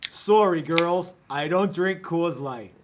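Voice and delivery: light mock Canadian accent. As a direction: Brad in a bad Canadian accent. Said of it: "he wouldn't do it with that accent".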